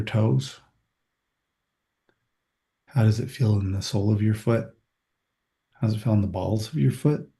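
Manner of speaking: walk them through their toes, unhurried; calm body scan meditation voice; guided body scan. Acted.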